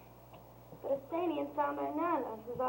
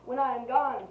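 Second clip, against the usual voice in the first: loud incantation; 2 semitones higher, 11 dB louder than usual. Acted. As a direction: call down the charm with force. Fairy casting a sleep charm.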